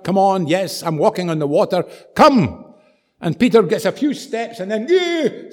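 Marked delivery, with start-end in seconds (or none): 0.0-2.7 raised acted beckoning shout; commanding call. Jesus calls Peter onto water. 4.9-5.5 very high acted yelp of alarm; sudden squeal of mock panic; startled yelp. Peter startled on the water.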